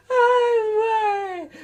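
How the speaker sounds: put-on high loud wailing oh boy; exasperated cry. Comedian wails to the audience, high and loud. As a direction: cry it out to the crowd. Comic exclamation between jokes.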